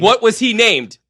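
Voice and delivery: high, loud, impatient question; insistent mock demand. Co-host pressing host for the award title, playfully insistent. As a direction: demand the answer with playful urgency.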